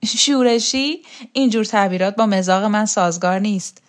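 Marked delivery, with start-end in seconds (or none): 0.1-1.0 higher, rising echo of the word, a touch amused; lightly amused echo. A narrator's reading. Roksana echoes the rebel label put to her, lightly amused. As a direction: toss the word back as an amused question. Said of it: "رکسانا خندید".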